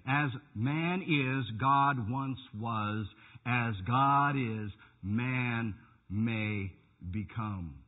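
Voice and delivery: very slow, weighty word-by-word quote; deliberate quoting. Retold or imitated. Preacher quoting a rival doctrine to his congregation, slow and deliberate. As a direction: spell out the doctrine one slow phrase at a time.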